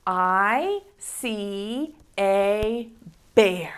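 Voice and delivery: very slow, word by word; slow reading. Shown as a demonstration. Teacher reading word by word to preschoolers. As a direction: point and read each word slowly. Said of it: "these words that we're going to read say".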